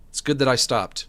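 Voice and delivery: normal talking voice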